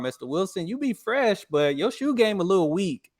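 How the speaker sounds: a student roasting the teacher; teasing student note. Retold or imitated. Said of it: "wrote on a note card".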